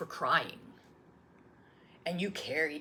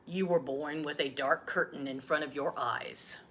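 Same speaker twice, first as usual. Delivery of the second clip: ordinary delivery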